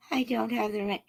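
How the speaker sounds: high, helpless damsel voice. Acted. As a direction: plead like a melodrama heroine. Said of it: "act out the whole live stream in different voices".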